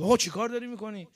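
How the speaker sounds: high, alarmed question; startled protest. Retold or imitated. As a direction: imitate a shocked student protesting. Student startled by teacher kissing hand.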